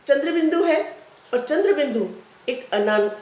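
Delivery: ordinary delivery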